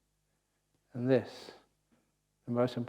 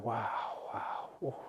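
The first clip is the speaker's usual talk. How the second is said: whispered wow; 8 dB quieter, slower than usual. Retold or imitated. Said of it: "watching a number two going".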